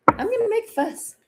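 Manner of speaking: tiny, very high put-on voice; high-pitched mock fuss. Declaring a readiness to disrupt, high put-on squeak. Playfully voicing a refusal to keep quiet.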